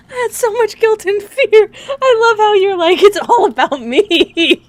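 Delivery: loud high wail through laughter; laughing to tears. Real, not acted. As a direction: cry the words out, helpless with laughter. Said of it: "I'm gonna cry".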